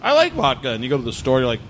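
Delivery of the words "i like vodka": high-pitched mock newcomer to vodka; a hint of a naive young drinker; teasing impression. Host teases guest about early drinking, high mock voice.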